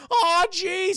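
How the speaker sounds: high, loud cartoon alarm; Shaggy. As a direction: yelp like a scared goofy sidekick. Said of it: "He's like".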